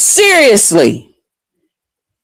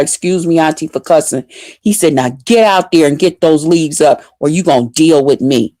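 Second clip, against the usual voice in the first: understated threatening big cousin; imitating stern cousin; 6 semitones lower than usual. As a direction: warn them low and firm. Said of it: "He's like".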